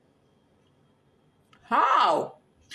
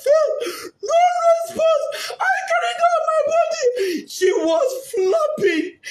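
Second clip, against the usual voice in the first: real crying; 7 semitones higher than usual. Real, not acted. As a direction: cry it out, voice breaking at the memory. Mother recounting finding her dead daughter.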